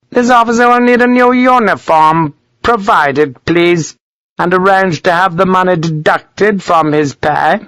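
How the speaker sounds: high-pitched, commanding dictation; imperious colonel. A narrator's reading. Colonel giving orders to his major, imperious.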